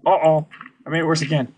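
loud, panicky outburst; alarmed. Real, not acted. Player makes the lava worse, loud alarm. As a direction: blurt it loudly in rising alarm.

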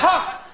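one sharp high "Ha!"; a lone high laugh, cut off; the friend's deadpan laugh again; single bark laugh. Retold or imitated.

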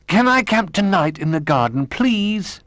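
put-on high pleading child voice, please rising; begging. A narrator's reading. Little Bear begs Old Bear to camp tonight. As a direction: wheedle for permission. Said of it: "begged Little Bear".

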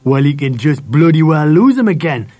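gruff-tempered angry order; shouted. A narrator's reading. Dad orders son to lose the dog, shouting. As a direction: shout him down. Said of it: "shouted Dad".

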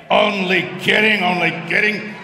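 loud teasing laugh in voice; joking loud. Acted. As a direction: shout it as a joke. Joking after "now bend over.

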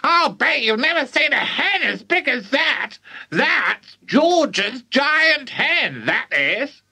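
put-on high screechy old woman; boastful high granny holler; shouting granny. A narrator's reading. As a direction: crow it from the rooftop. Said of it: "Grandma started shouting at her".

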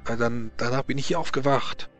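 put-on stammering Frenchman, heavy French accent; nervous French nobleman voice.

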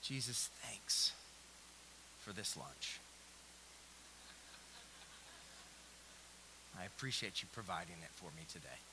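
mumbled routine grace; mock tame prayer. Shown as a demonstration. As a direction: rattle off a lazy grace. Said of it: "to this level".

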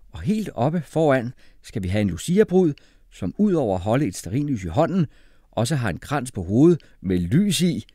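upbeat, animated instructions; cheerful explaining. A narrator's reading. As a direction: explain it gladly to a newcomer. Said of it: "forklarede Jeppe glad".

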